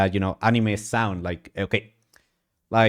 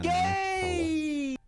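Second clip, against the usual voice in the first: anime squeal; 7 dB quieter than usual. Pretend. Mock anime cry in booth.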